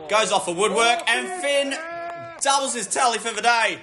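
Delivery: high, rising goal commentary; excited goal call. Real, not acted. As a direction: lift the voice as it goes in. Commentator calls second goal.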